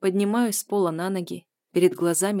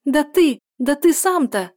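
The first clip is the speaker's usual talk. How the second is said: very high, loud indignant squeal; 9 semitones higher, 9 dB louder than usual. A narrator's reading. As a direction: shriek back at him. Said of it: "визжит она".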